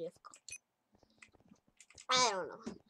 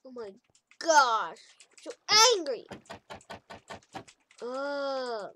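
normal talking voice

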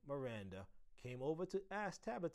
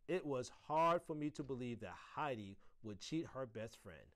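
usual speaking voice

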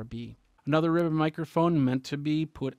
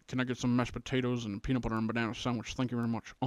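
[name] voice; 3 dB quieter, much faster than usual. Retold or imitated. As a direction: do a fast [name]. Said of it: "if you watched anything [name]".

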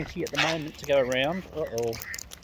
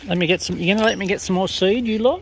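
raised, higher voice telling the birds off; playful scolding of greedy birds, louder; mock protest; 4 semitones higher, 6 dB louder than usual. Real, not acted. Pushy birds crowding, speaker protests.

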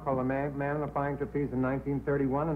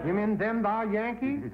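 higher, slow, hammed-up Southern accent; mock southern drawl; 6 semitones higher than usual. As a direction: play the Carolina yokel for a laugh. Host mimics southern contestant's dialect.

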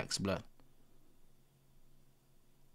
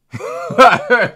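a big real laugh that breaks out; loud burst of laughter; 7 semitones higher, 13 dB louder than usual. Real, not acted. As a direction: laugh out loud at your own story. Laughing at his own rant.

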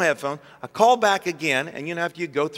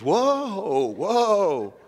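long, swooping whoa; mock-startled slow-down call; taken aback; 2 semitones higher than usual. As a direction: draw each one out, rising then falling. Store worker halts upset customer.

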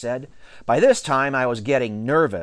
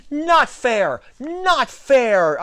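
high, indignant outcry; loud protest; 12 semitones higher, 4 dB louder, much slower than usual. A narrator's reading. Alex objecting to T J's catcher, protesting loudly. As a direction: cry out in high-pitched protest.

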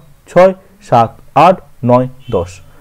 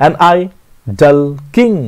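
an idle king read very slowly with stress on king; teacher scanning the line with the stress on king; iambic scansion; much slower than usual. Shown as a demonstration. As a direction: beat it out slowly, landing on king. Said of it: "কিংয়ে পড়ছে".

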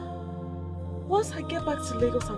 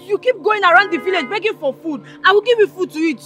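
scolding; 3 semitones higher, 9 dB louder than usual. Acted. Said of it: "I'm angry with you".

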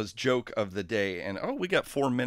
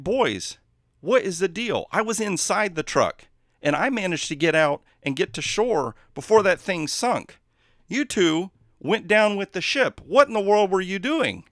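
higher, louder incredulous scolding; exasperated driver; 8 semitones higher, 6 dB louder than usual. Acted. Driver scolds the boys who sank, exasperated. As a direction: demand an answer, bewildered.

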